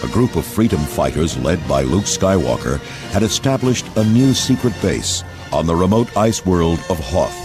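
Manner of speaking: ordinary delivery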